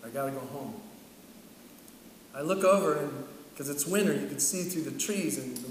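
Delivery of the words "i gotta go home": despairing thought. Retold or imitated.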